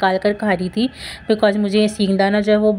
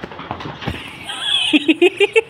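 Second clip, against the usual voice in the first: breathy high laugh; 11 semitones higher than usual. Real, not acted. Laughing during play.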